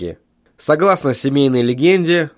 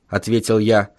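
ordinary delivery, nothing marked

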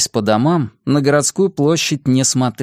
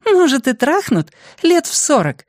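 put-on high-pitched girl's wry retort; high, teasing girl. A narrator's reading. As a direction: tease back in a high girlish voice. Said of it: "усмехнулась Нори".

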